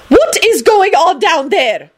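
high, drawn-out indignant shriek. A narrator's reading. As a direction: shriek it down the stairs. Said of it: "McGonagall shrieked from the top".

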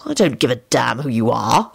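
low, impatient dismissal; understated irritation. A narrator's reading. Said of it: "Lupin replied impatiently".

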